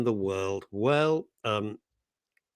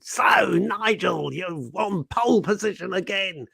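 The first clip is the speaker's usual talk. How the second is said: over-excited commentator, higher and slow-stretched shout; Murray Walker; 6 semitones higher than usual. Acted. Commentator hailing Nigel on pole position, excited impression. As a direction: shout it like a thrilled motor-racing commentator. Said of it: "Murray Walker would be so excited".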